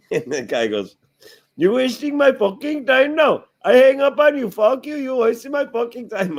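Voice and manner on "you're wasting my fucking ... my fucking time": high, loud mock rant in an Indian accent; angry Indian telemarketer. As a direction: imitate a caller going off, pitched way up. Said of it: "this Indian guy's just going off on my cousin".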